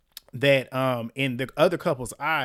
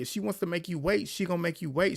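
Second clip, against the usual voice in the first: ordinary delivery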